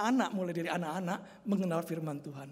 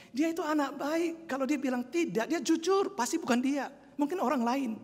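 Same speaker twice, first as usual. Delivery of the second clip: put-on higher, louder, firmly vouching for the boy; insistent teacher; 6 semitones higher, 4 dB louder than usual. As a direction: stand up for the good child. Teacher defends the child. (guru sekolah minggu membela anak dengan yakin)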